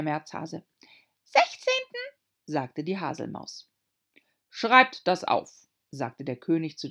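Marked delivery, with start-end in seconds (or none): 1.2-2.0 very high tiny squeak; squeaky little dormouse. A narrator's reading. Dormouse voiced. 4.5-5.7 slow, loud royal command; pompous king orders. A narrator's reading. King voiced.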